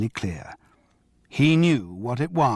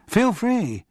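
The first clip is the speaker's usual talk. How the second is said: voiced Miles; 11 semitones higher than usual. Miles answering Charles.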